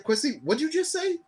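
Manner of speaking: can't believe what he heard; high incredulous question. Retold or imitated. Asking his Ghanaian classmate, high incredulous question. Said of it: "I was like".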